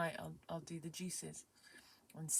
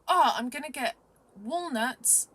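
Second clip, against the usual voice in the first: excited recipe announcement; 7 semitones higher, 12 dB louder than usual. Retold or imitated. Acting out her excited recipe telling.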